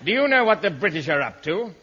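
hammed-up impatient long-distance call; exasperated hailing.

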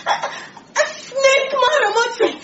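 slow, high, breathless fear; frightened. Acted. Secretary naming the snake to her boss, high slow fear. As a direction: force the word out in dread.

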